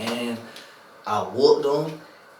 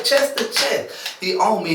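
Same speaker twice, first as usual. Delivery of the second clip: ordinary talk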